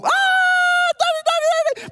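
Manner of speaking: very high, loud shriek for Daddy; terrified screaming. Acted. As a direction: act a little boy screaming in panic. Boy screams for dad again.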